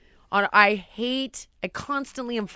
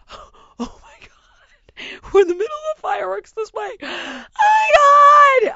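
from a gasped whisper to a high shriek; gasp, then scream it in disbelief; shocked disbelief; 14 semitones higher, 10 dB louder than usual. Retold or imitated. To Heidi amid the fireworks, whisper building to a shriek. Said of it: "I looked at Heidi and I was like".

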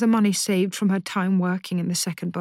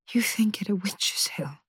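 whisper; 6 dB quieter than usual. Acted. Daughter quietly confronting mother.

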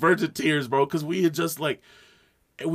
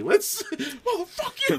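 laughing curse; slower than usual. Retold or imitated.

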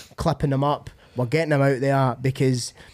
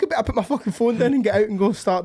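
flustered, high-pitched self-scolding; mock panic; 8 semitones higher than usual. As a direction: scramble into action as if just told off. His flustered reaction to being berated.

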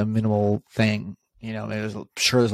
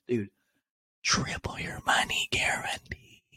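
hushed scammy whisper; conspiratorial salesman whisper; whispered pitch; 7 dB quieter than usual. Acted. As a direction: whisper it like a secret tip. Pitching a shady investment.

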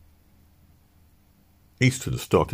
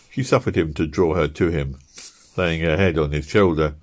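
usual speaking voice

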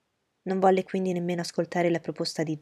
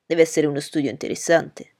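put-on louder, eager young gentleman; Bingley's voice; 2 semitones lower, 8 dB louder than usual. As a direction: reassure her warmly and firmly.

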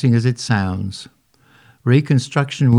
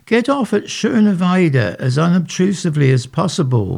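put-on elderly lady; 9 semitones higher than usual. A narrator's reading. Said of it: "one elderly lady advised".

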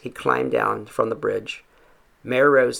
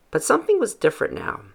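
ordinary delivery, nothing marked

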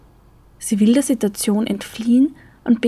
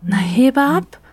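higher, urgent call; 5 semitones higher, 3 dB louder, slower than usual. A narrator's reading. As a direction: urge him to pick up. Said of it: "ruft Franzi".

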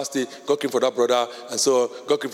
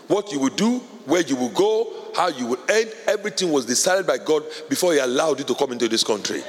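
normal talking voice

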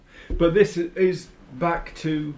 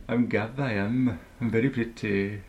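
put-on Welsh accent; 4 semitones lower, 5 dB quieter than usual. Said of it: "attempt to do, uh, a Welsh accent".

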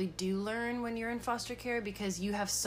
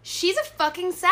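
loud, high triumphant shout; excited reveal; 11 semitones higher, 10 dB louder than usual. Real, not acted. Co-host revealing the sign just looked up, excited shout. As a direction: blurt out the big result at full voice.

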